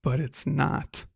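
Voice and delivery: slow, soft ominous reveal; hushed dramatic reveal. Acted. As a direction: drop it quietly like a twist. Dramatic twist after pause.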